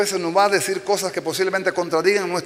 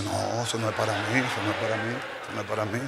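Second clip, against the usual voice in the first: softer, lower, muttering it away; mild reluctant mumble; 8 semitones lower, 7 dB quieter, much faster than usual. Acted. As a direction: push the verse away under your breath. Hombre rechaza versículo, murmurando. (murmullo bajo y renuente)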